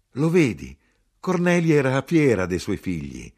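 high, loud, emphatic exclamation; animated insistence. A narrator's reading. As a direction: make the point with eager force. Narrator acts excited dialogue.

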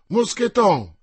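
loud, high call for a servant. A narrator's reading. D'Artagnan at the cellar door summoning Moscheton, calling out loudly. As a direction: call him up from below. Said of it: "chiamò".

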